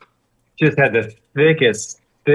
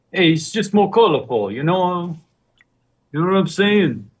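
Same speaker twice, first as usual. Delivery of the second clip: higher, hammed-up street voice; mock street talk; 6 semitones higher than usual. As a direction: play it like a cool guy. Tossing off a street voice.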